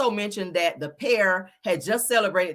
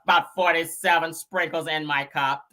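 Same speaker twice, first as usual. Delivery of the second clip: mock witness; 3 semitones lower than usual. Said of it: "That was her in court".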